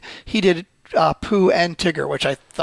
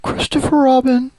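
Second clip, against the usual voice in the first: higher, sweet storybook bear voice; Winnie the Pooh; 7 semitones higher, 3 dB louder than usual. Acted. Cohost showing off a bear impression, childlike and pleading. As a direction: plead like a cuddly cartoon bear. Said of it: "I used to do Winnie the Pooh much better".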